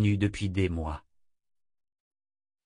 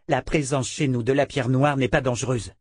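ordinary delivery, nothing marked

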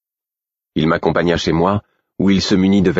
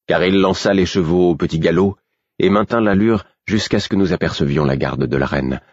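plain reading voice

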